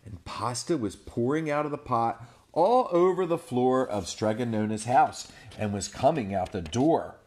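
put-on lively read-aloud to children; animated storyteller voice; storybook narration. A narrator's reading. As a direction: tell it big for the little ones. Reading picture book aloud.

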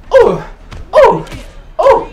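three short, high, loud cries; sharp yelps. Real, not acted. Player taking punches one by one, sharp high yelps. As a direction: yelp at each blow. Takes hits in the game.

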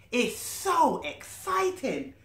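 slow, stretched, thrilled little voice; excited squirrel. Acted. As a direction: gush with excitement, drawn out. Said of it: "shouts Roly".

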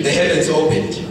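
ordinary delivery

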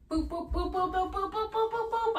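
rising run of high-pitched boops; playful booping. Real, not acted. Playful noises while putting clogs on.

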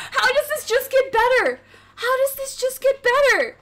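high-pitched, amazed exclamation; thrilled disbelief. Real, not acted. Streamer whose birthday summons keep improving, thrilled disbelief.

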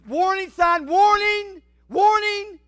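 high, loud cry of warning, warning; shouted warning. Acted. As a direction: sound the alarm at full voice. Preaching peak.

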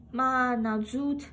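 ordinary delivery

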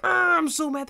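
high, loud mock-angry yell; cartoonish mad outburst. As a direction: act out an over-the-top tantrum. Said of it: "when I get mad, I'm like".

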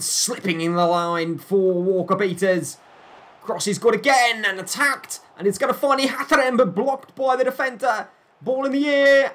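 high, loud commentary rush; excited play-by-play. Real, not acted. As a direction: call the attack as it builds to a peak. Live attack in the box.

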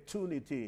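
"tunity" as "too-nity"; plain T opening "tunity", the American way; American pronunciation. Shown as a demonstration. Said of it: "ออกเสียงเป็น tunity". (ออกเสียง "tunity" แบบอเมริกันว่า "ทูนิตี")

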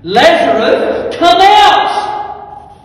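huge, slow, high call; acted full-voice order, each word stretched; commanding shout. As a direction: bellow the command to raise the dead. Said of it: "He commanded".

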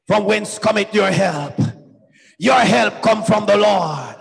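usual speaking voice

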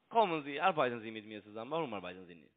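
ordinary talk, nothing marked

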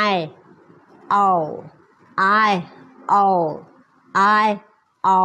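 "I" and "เอา" said as separate sounds; isolated teaching syllables, "I" then "เอา"; split sounds of I'll. Shown as a demonstration. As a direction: break "I'll" into "I", pause, "เอา". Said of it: "เวลาออกเสียง".

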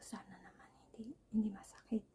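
hushed whisper, half a prayer; whispered hope. Retold or imitated. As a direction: whisper it anxiously to yourself. Nervous hope before extraction.

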